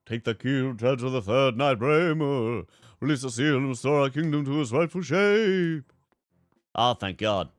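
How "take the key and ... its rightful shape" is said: loud, higher, declaimed game knight; grand knightly announcement; put-on knight voice. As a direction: proclaim it as a knight, drawing out key words. Voices game character's dialogue.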